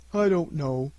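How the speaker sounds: usual speaking voice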